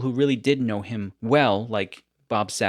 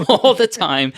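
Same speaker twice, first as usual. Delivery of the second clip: loud, higher laughing repeat; 7 semitones higher, 9 dB louder than usual. Real, not acted. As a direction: burst out louder through a laugh.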